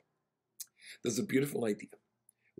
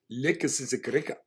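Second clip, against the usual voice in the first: put-on louder South African accent, a touch higher; South African slang; 4 semitones higher, 6 dB louder than usual. Rabbi answers the passenger in South African slang, South African accent. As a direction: toss it off like a local from Cape Town. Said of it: "which is South African for okie dokie".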